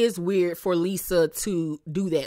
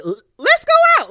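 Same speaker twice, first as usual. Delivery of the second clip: much higher and louder, quick breezy invitation; mock chirpy Lisa; 9 dB louder, faster than usual. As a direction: toss it off like nothing happened. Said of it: "and said".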